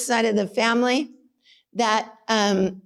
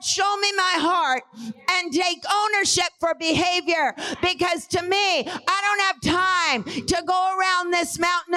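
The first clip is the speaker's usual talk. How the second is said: high, ringing preacher's shout; preaching; 6 semitones higher than usual. Acted. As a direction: preach it with full force. Preaching at full force.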